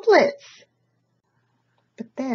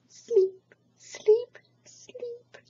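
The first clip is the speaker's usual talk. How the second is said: high sleepy voice fading into a whisper; tired whisper; much slower than usual. Acted. Tired babies fall asleep, fading from high voice to whisper. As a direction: let it drift off into a whisper. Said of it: "the tired babies finally whispered".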